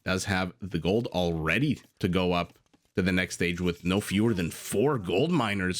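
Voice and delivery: usual speaking voice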